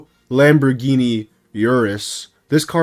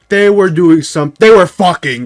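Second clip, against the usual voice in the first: loud, higher-pitched exclamation; blurted outburst; 7 semitones higher, 10 dB louder than usual. Acted. Telling viewers what two people were doing, loud scandalized outburst. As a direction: burst out with it, raised voice.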